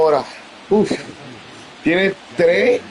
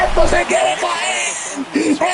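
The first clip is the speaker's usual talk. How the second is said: ecstatic shouting; 11 semitones higher, much slower than usual. Real, not acted. Fan celebrates the double play with high drawn-out shouts. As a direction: roar with joy at the play.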